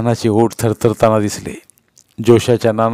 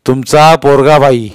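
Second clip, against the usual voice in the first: loud, slow, raised officer's voice; intimidating official tone; stern browbeating; 4 semitones higher, 7 dB louder, slower than usual. A narrator's reading. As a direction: bear down on her as police. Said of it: "police आईला दरडावत म्हणाले".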